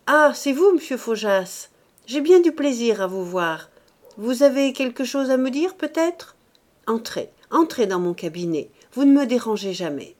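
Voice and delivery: nervous bishop hurrying his visitor inside; effusive bishop. A narrator's reading. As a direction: gush politely at an unwanted guest. Bishop greets unwelcome visitor.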